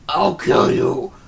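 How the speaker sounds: raging puppet threat; berserk rage. Pretend. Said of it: "do a puppet show".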